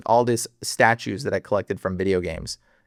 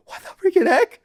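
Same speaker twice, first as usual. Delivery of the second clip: shocked disbelief; 3 dB louder than usual. Retold or imitated. Shocked by verse.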